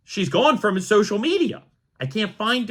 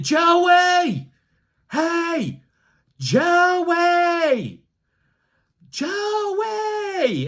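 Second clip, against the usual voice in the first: heckling shout; 11 semitones higher, much slower than usual. Retold or imitated. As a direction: call out a player's name from the bleachers. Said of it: "So I start screaming".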